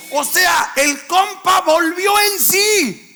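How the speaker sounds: preacher shouting the turning point; preaching shout. Real, not acted. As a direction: shout it from the pulpit. Preacher climaxes the phrase.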